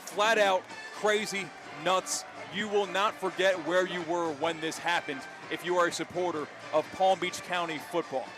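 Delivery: ordinary talk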